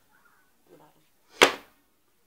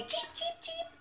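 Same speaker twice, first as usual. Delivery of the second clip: high, squeaky story-book cheeps; chick cheeping; 8 dB quieter than usual. Acted. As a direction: sing out tiny chick peeps. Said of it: "chicks are singing".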